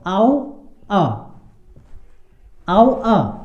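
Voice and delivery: "आओ अ" as separate sounds, au then a short uh; demonstrating pronunciation. Shown as a demonstration. Teacher models a vowel sound for learners, "आओ अ" sounded out separately. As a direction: sound out "आओ अ" with a gap before the short final vowel. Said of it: "इसका उच्चारण क्या आता है?".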